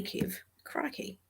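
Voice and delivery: breathy whisper, half to herself; whispered aside. Real, not acted. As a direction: drop to a whisper for the tease. Teasing aside to friend.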